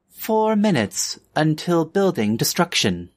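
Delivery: flat robotic announcement; automated announcement voice. Acted. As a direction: read it like a building PA system. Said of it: "an automated voice go".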